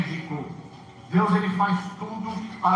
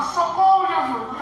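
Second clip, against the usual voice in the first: a high, loud, tearful cry of Socorro, Jesus; crying out for help; 9 semitones higher, 4 dB louder, slower than usual. Retold or imitated. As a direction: wail for help, much higher and louder. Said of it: "e chorava". (grito agudo e choroso por socorro)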